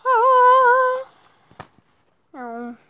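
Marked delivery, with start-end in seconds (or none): 0.1-1.1 sudden loud high shriek; high scream. Acted. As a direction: scream when grabbed. Attacked in game.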